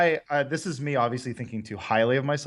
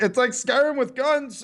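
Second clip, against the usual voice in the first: high, slow mock breakdown; 12 semitones higher, slower than usual. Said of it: "the mental breakdown moment where I'm like".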